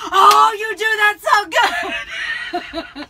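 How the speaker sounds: high, loud, slow gushing through laughter; imitating a gushing dinner companion, laughing; gushing praise. Dinner companion praising the accent, high and loud. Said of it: "the person I was next to was like".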